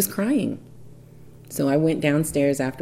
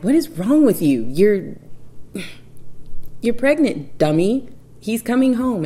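higher, louder, calling him a dummy; exasperated scolding; 8 semitones higher, 4 dB louder than usual. Retold or imitated. Wife scolding her husband about the pregnancy, exasperated and loud. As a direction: scold him like he's missing the obvious. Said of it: "And I'm like".

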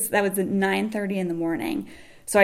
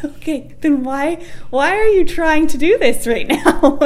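laughing disbelief; 9 semitones higher, 4 dB louder than usual. Real, not acted. Laughing at the ultrasound tech.

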